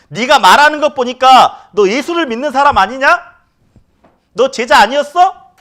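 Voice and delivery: high, loud accusing question; mock accuser pressing Peter; accusing bystander. Bystander accusing Peter, high loud challenge.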